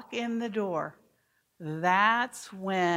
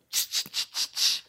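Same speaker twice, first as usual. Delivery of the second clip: unvoiced raptor clicks; breathy ch ch ch of curious raptors; velociraptor chatter. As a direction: hiss like the raptors in Jurassic Park. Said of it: "like those little velociraptors".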